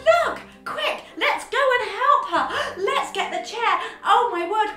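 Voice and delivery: high, loud, breathless alarm; urgent excitement. Acted. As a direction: rouse everyone to help Granny. Spotting Granny, rushing to help.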